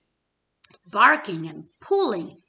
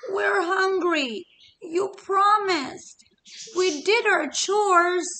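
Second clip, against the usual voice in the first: put-on higher, slow childish whining; whiny chicks; 9 semitones higher, 4 dB louder, slower than usual. Chicks complaining to Hen about the beans, slow high whine. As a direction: plead like hungry little chicks.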